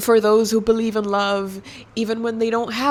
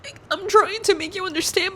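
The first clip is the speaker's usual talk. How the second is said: near tears; 11 semitones higher, faster than usual. Real, not acted.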